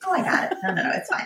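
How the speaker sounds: high, breezy "I got it" brush-off; self-quote, the capable mom. Retold or imitated. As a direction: chirp it off as if it's nothing. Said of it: "one of the things I always say".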